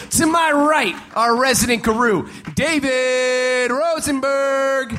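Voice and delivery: name stretched out slow like a ring announcer; bigger announcer take. Shown as a demonstration. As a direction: hype it up this time. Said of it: "let me try that one more time".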